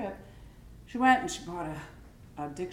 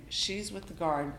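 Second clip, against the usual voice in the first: ordinary delivery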